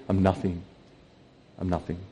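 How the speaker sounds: low, hushed self-abasement; humble admission. Acted. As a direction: let it drop away softly. Same words repeated as humble admission.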